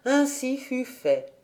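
ordinary delivery

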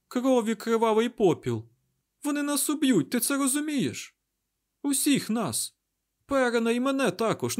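high-pitched, agitated alarm; panicked outburst. A narrator's reading. Mat warns Rand they will all be killed, panicked. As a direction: pour it out high in rising panic.

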